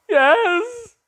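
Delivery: very high, loud put-on yes; imitated lost kid. Lost student spotting his leader, high imitated cry. Found student's reply, voiced.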